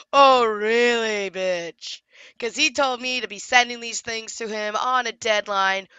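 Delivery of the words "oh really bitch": sarcastic retort. Retold or imitated. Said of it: "And I go".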